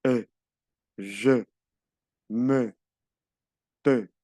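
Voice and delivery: E, je, me, te each with a short "uh"; French schwa E. Shown as a demonstration. Teacher models French E for students, short schwa in "je, me, te". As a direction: separate short words, dull E vowel. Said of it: "La E se pronuncia E".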